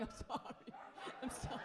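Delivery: whispered "I'm sorry" from afar; whispered apology. Retold or imitated. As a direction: breathe the sorry, barely voiced. Apologizing from the distant cockpit.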